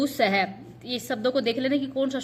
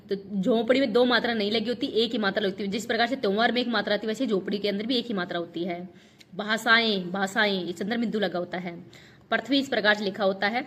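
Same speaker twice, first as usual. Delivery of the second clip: ordinary delivery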